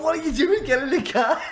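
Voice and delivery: mock policeman's order. Policeman to runaway passenger on Edge Lane, mock exasperated order. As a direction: order him back in, through laughter.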